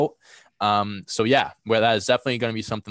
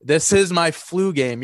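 emphatic hype; 7 semitones higher, 6 dB louder, slower than usual. Acted. Jokingly claims his flu game.

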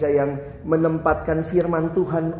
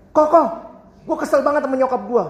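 put-on higher, louder, fast whiny teen; Jakarta teen; 6 semitones higher, 4 dB louder than usual. Teenager complains to the pastor about his mother, high and fast. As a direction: complain like a fed-up Jakarta kid. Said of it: "Anak Jakarta ya".

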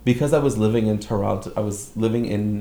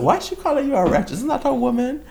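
high, quick lightly voiced grandma; puzzled grandma. Grandma confused about the word wretch, high puzzled voice. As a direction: ask it like a baffled old grandmother.

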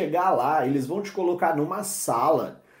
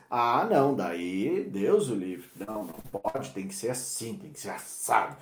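mock strictness; 8 semitones lower, 6 dB quieter than usual. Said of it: "quando você tá falando em inglês".

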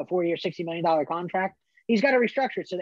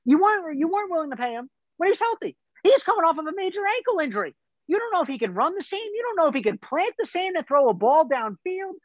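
high-pitched, worked-up argument; real agitation, pitched way up; heated rant; 8 semitones higher than usual. Real, not acted. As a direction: argue it with exasperation, voice raised in pitch. Heated rant about Dallas contract.

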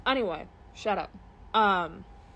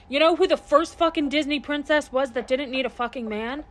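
fired-up rant; 5 semitones higher, 7 dB louder than usual. Real, not acted. Ranting about Disney princesses.

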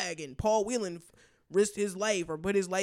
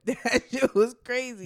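laughing; 5 semitones higher than usual. Real, not acted. Laughs on the line.

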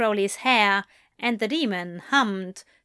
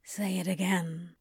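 mumbled; 2 semitones lower, 7 dB quieter than usual. A narrator's reading. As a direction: mumble it against him. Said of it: "Crowley mumbled".

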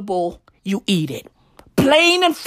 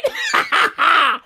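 cracks up at himself; laugh; 4 semitones higher than usual. Real, not acted. Laughing at own punchline.

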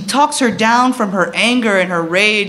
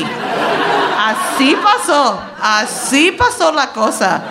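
slow, slurred, a bit higher; drunk aunt; 5 semitones higher, slower than usual. Retold or imitated. Drunk aunt confirming the story, slow slurred drawl. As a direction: drag it out like a tipsy aunt. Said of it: "her drunk ass was like".

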